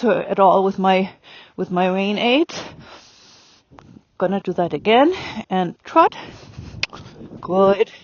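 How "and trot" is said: TROT rising at the end; upward transition command. Shown as a demonstration. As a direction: lift the voice on "trot". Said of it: "the voice goes up".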